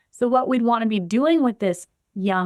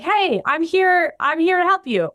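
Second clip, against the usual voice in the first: super excited; 9 semitones higher, 3 dB louder than usual. Pretend. A foster parent greeting the withdrawn boy, mock over-eager and much higher. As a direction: burst in eager to help. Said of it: "like, super excited".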